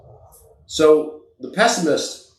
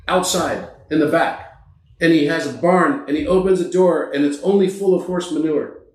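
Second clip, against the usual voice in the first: ordinary talk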